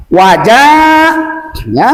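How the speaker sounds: Wajahnya with the "ja" held very long; long vowel. Shown as a demonstration. Teacher demonstrates lengthening, Wajahnya with long ja. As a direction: stretch the ja slowly.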